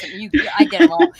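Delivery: fast, high, laughing through it. Real, not acted. Telling friends she sent every gif, laughing. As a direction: giggle out the brag.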